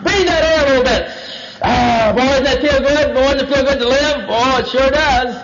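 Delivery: high, savoring serpent sales pitch; gushing put-on delight; positive, coaxing serpent. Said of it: "He started on the positive".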